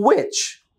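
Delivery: "Which" with long rounded w, jumping high; exaggerated pronunciation demo. Shown as a demonstration. Teacher drilling "Which" for learners, exaggerated w. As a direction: hold the oo, then pop "Which" out high. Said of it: "কি হবে?".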